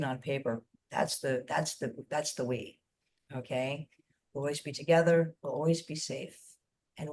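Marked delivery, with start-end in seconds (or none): none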